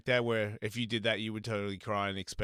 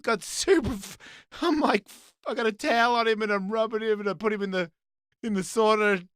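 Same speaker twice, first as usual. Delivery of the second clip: normal talking voice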